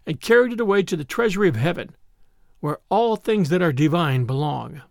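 plain reading voice

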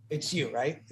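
low, hushed question; acted discreet probing of a friend; knowing aside. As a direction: ask it softly, already sure. Acts friend gently seeing through lie.

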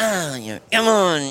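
frustrated groan. Retold or imitated. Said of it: "and you're like".

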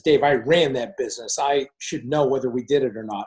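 usual speaking voice

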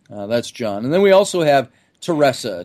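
normal talking voice

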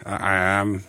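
lightly voiced sneaky shark; a hint of a shark at the door; menacing shark. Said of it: "did the shark voice".

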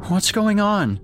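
put-on high, small child's voice; a scared boy just woken up; frightened child. A narrator's reading. Boy waking to screams asking what's going on. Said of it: "Jake asked".

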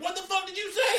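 very high, loud fake outrage; mock outraged yell. Prankster calling back at the driver, mock outraged yell. Man acts outraged from afar.

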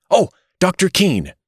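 high-pitched hail, falling at the end; calling out. A narrator's reading. Mister Button running toward the doctor, calling out. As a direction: call after someone to stop them. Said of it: "he called".

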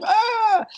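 short high yelp; high sharp cry. Retold or imitated. Retold cry during labor.

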